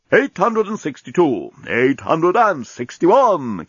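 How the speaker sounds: slow, measured countdown announcement; talking bomb counting down. Acted. Talking bomb announcing its countdown, slow and ceremonious. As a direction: draw each number out with ceremony. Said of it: "continued the bomb".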